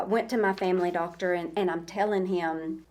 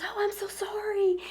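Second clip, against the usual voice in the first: whispered sorry; 13 semitones higher than usual. Retold or imitated. Volunteer apologizing to her in a high whisper. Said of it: "she goes".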